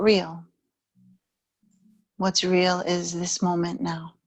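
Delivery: calm meditation guide, unhurried with long pauses; guided meditation. Real, not acted. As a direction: ease the listener into stillness, evenly and gently.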